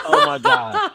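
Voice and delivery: high, loud laughing exclamation; real laughter, squeaking up. Real, not acted. Caller laughs at himself on the radio, loud high laugh.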